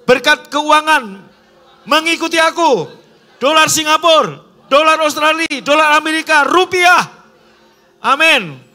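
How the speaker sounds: loud, high faith declaration; bold declaration. Shown as a demonstration. Preacher modelling a declaration of blessing, loud and high. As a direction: shout the blessings as if already received. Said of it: "sekarang ngomong".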